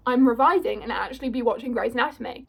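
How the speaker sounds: ordinary delivery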